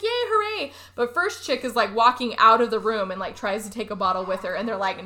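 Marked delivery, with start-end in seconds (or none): 0.0-0.7 mock group cheer.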